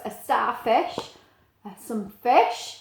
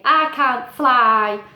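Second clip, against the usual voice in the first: slow, louder penguin voice; penguin Pete; 9 dB louder, much slower than usual. Acted. Pete telling Steve he can't fly, slow and loud. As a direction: plod it out like a sturdy penguin.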